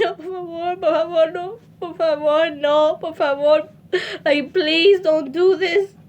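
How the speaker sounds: high, tearful repeated por favor; sobbing plea in Spanish. Retold or imitated. Pleading with her mom in Spanish, sobbing. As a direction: beg over and over through tears. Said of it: "I screamed".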